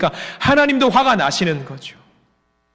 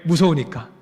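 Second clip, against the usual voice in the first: ordinary talk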